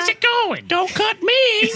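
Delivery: very high-pitched cartoon organ voice; tiny high squeak of a pleading gut, drawn out at the end; talking intestines. Intestines talking to the surgeon mid-surgery, squeaky cartoon voice. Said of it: "The intestines start talking to you".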